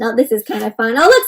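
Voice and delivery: ordinary talk, nothing marked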